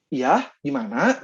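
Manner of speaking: slow, lilting Sundanese intonation on Ya, gimana?; relaxed Sundanese-accented reply. Shown as a demonstration. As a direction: say Ya, gimana? with a drawn-out Sundanese lilt. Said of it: "dengan dialek-dialek dan intonasi Sundanya".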